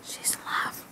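whispered teasing aside. Real, not acted. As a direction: whisper it behind her back.

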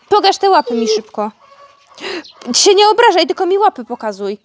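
mock scolding. Real, not acted. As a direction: scold the muddy puppy, half in fun. Scolding the dog about muddy paws.

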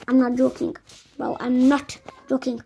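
usual speaking voice

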